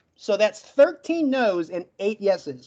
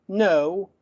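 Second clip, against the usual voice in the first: ordinary talk, nothing marked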